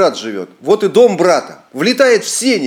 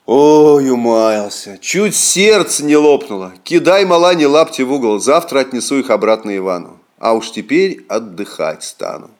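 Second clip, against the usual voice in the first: exhausted. Acted. Exhausted after running.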